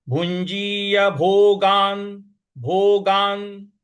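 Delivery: भोगान drawn out very slowly, weight on the न; stress demo. Shown as a demonstration. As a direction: lean on the न of भोगान. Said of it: "न पर हमको ... आघात देना है". (भोगान के न पर आघात)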